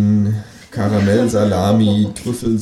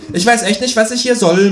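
high, louder sulky grumble; grumbling kangaroo; 13 semitones higher, 6 dB louder than usual. A narrator's reading. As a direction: sulk it out as the kangaroo. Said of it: "mault das Känguru".

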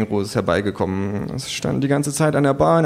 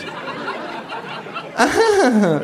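higher, drawn-out mocking laugh; kangaroo laughs; 13 semitones higher than usual. A narrator's reading. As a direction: laugh along slyly. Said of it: "lacht auch das Känguru". (lachendes Känguru)

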